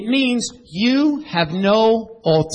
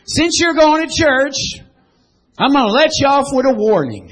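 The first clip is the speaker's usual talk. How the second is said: much higher and louder, booming cop; loud officer; 7 semitones higher, 6 dB louder than usual. Acted. Preacher replays the officer letting the driver off, loud and high. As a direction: boom it out as the pardoning officer. Officer line repeated with force.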